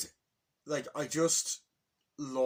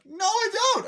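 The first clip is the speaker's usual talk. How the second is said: high, loud frightened no; squealing denial; 12 dB louder than usual. Retold or imitated. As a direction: squeal it out in terror. Luigi denying, squealing.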